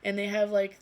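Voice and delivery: normal talking voice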